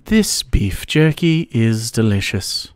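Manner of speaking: low, slow English accent; deep-pitched, measured English voice. Shown as a demonstration. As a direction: put on a trustworthy English accent. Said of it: "with an English accent".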